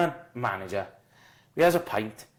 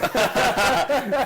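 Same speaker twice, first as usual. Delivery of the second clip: burst of laughter; 13 semitones higher, 7 dB louder than usual. Real, not acted. Laughs at his own story.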